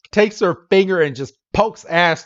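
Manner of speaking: usual speaking voice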